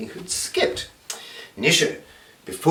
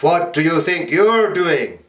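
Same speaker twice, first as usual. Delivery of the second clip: woodcutter; 5 semitones higher, 5 dB louder, slower than usual. Acted. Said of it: "he said to the wolf".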